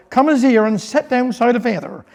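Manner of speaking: usual speaking voice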